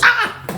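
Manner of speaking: high, sharp imitated scream; imitating the victim's scream from the cell, very high; mock scream. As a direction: let out a short yelp.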